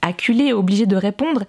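ordinary delivery, nothing marked

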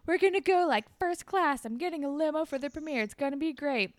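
high, eager imitation; excited bragging. Boy announcing his premiere limo to friends, excited boast.

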